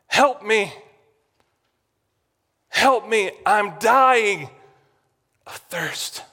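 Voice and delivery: building cry for rescue, falling away at the end; desperate plea. Acted. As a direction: cry out to God, high and urgent. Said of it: "And the psalmist desires".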